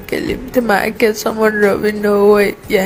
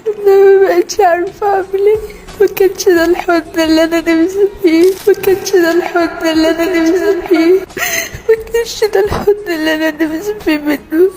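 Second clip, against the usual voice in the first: breaking into sobs, voice pitched up and pushed; crying; 5 semitones higher than usual. Real, not acted. Daughter confessing her father's abuse, sobbing. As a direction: cry it out, overwhelmed and lost.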